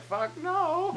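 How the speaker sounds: high-pitched mock refusal from a shark; jokey high shark voice; voicing the shark. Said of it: "the shark was like".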